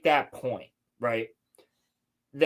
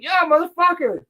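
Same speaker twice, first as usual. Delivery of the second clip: high, loud shout across the street; friendly shout; 5 dB louder than usual. Retold or imitated. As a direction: shout it out to a buddy. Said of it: "he was like".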